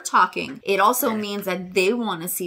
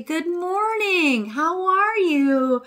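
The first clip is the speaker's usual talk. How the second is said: high, slow and bright greeting; bird-talk greeting; 10 semitones higher, 3 dB louder, much slower than usual. Shown as a demonstration. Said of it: "When you say".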